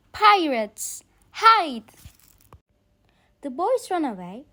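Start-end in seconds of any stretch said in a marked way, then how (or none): 0.1-1.9 loud, higher urgent yell; yelled warning. Acted. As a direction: yell it out to warn them. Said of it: "Peter yelled".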